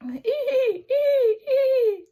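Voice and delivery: high squeaky creaking; creaking windmill. Acted.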